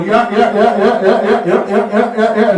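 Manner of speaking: hammed-up New York Italian yeahs. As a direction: rattle it off like a New York wiseguy. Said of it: "and you go".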